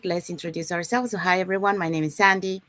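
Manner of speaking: normal talking voice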